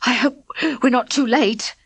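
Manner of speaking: panting between words; breathless from running; out of breath. A narrator's reading. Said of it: "panted Spag".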